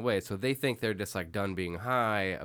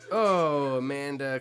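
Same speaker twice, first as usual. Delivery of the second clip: exasperated groan; 5 semitones higher, 7 dB louder, slower than usual. Real, not acted. Heckling a character being watched.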